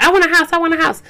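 high, insistent mock demand; mock wishing. Mimics herself merely wishing.

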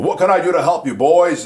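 a big Southern boss shouting a friendly offer to his cooks; bellowing Southern voice. Retold or imitated. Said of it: "in his big bellowing Southern voice".

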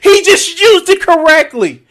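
he just used it correctly, cried out high and loud; shouted disbelief. Real, not acted. Reacting to the clip, a high, loud mock-anguished cry.

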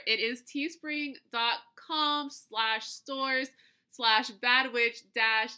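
slow, careful spelling of a web address; slow dictation. Real, not acted. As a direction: read the link out slowly. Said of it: "nope, I messed it up".

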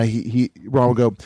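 ordinary delivery

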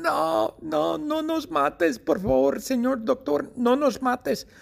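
very high, squeaky cry for mercy; terrified pleading. Acted. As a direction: beg for your life in a tiny high-pitched voice. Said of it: "piensan que Mateo va a matarlas y gritan".

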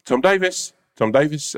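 high called-out name up the stairs; calling out a name. Retold or imitated. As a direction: call him like a receptionist. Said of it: "shouts out and says".

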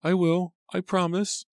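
very high little maid voice; small, obedient servant girl; meek. A narrator's reading. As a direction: promise timidly, high-pitched. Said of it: "Lucy replied meekly".

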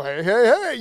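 hammed-up radio hello. Shown as a demonstration. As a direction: put on a playful radio DJ voice. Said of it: "radio voice that I go into".

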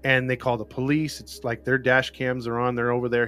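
ordinary talk, nothing marked

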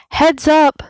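high, loud warning shout; heads-up shout. A narrator's reading. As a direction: call it out across the rock. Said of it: "someone shouts".

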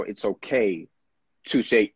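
ordinary delivery

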